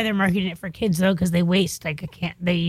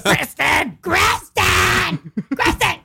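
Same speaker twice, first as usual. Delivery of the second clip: high shouted call of a name; shouting a name; 10 semitones higher, much slower than usual. Retold or imitated. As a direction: yell it like an angry mom. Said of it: "that is your voice".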